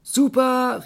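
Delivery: high, loud cheer; cheering call. Acted. Animals applaud the elephant's plan, calling out. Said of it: "riefen die Tiere".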